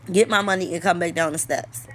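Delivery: normal talking voice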